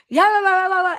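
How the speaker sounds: imitated loud babble. Host imitating voices heard in the building, loud babble. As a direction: imitate loud, garbled voices in rapid nonsense syllables. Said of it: "a really loud".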